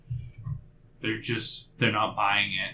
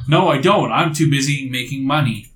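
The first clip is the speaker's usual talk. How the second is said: acted squabble, voice raised; indignant retort; 3 semitones higher, 6 dB louder than usual. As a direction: snap back at a rival. Acted quarrel with rival alchemist.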